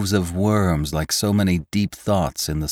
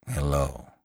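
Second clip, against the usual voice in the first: low, soft, tired hello; weary; 4 semitones lower, 8 dB quieter than usual. A narrator's reading. Lantry answering the driver who stopped, weary. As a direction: answer wearily, dropping low and quiet.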